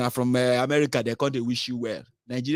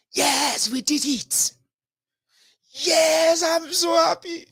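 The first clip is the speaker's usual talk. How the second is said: high, squealing mock celebration; mock joyful celebration; much slower than usual. Mocking his audience, ecstatic squealing fan. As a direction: mimic a fan overjoyed at the win.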